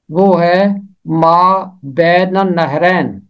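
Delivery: usual speaking voice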